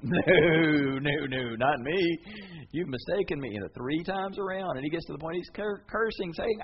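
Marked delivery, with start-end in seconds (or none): 0.0-3.5 loud burst then hurried; panicked denial. Pretend. Peter denying Jesus to the girl, flustered. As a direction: brush her off in a fluster.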